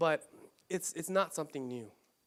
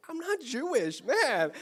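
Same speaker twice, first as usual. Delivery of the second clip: mock surprise; 6 semitones higher, slower than usual. His surprised answer to the Germans.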